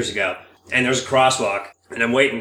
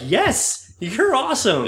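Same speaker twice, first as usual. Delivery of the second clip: retold delighted reply; 10 semitones higher, much slower than usual. Acts out delighted reply to strangers.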